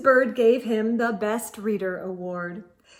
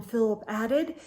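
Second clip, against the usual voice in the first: usual speaking voice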